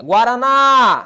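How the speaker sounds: "Guaraná" with a long, loud final NÁ; stress on last syllable. Shown as a demonstration. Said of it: "sílaba tônica é a última". ("Guaraná" com o NÁ final forte e alongado)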